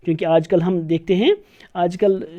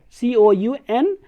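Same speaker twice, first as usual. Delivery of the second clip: slow letter-by-letter dictation; slow teacher spelling; slower than usual. Shown as a demonstration. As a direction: spell each letter slowly. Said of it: "British English में हम लिखते हैं".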